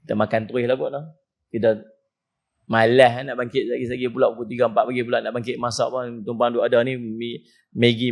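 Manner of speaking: low, fast, mumbling husband excuse; husband justifying a midnight Maggi, low and quick; lazy husband. Retold or imitated. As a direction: brush it off like a sleepy husband. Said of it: "suami dia kata apa".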